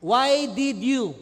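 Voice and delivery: very slow, loud accusing question; angry accusation. Acted. Preacher repeating the Israelites' words to Moses, loud accusing. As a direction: stretch out every word in blame. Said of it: "Tingnan ninyo yung mga words".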